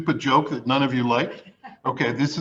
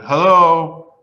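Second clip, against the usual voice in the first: louder, higher phone greeting; loud hello; 5 semitones higher, 11 dB louder than usual. Retold or imitated. Injured man greeting ambulance dispatcher, loud. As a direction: call down the line.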